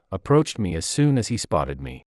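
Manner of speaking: ordinary delivery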